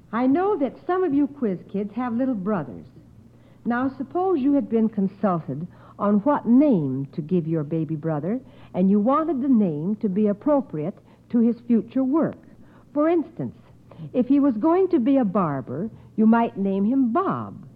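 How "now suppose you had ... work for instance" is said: ordinary talk, nothing marked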